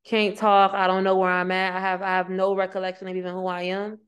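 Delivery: ordinary talk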